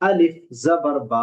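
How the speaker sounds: slow, spelled out with বা stretched one alif; slow Quran-reading spelling demo; drawn-out spelling. Shown as a demonstration. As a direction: spell আলিফ জবর বা, holding বা one beat. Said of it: "এক আলিফ টানলাম".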